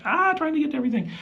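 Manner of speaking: high, fast, overwhelmed squeal; frazzled. Retold or imitated. Dad answering kids about video games, frazzled high squeal. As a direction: squeak it out like you're drowning in tasks. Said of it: "I'm like".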